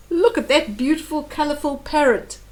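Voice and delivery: plain reading voice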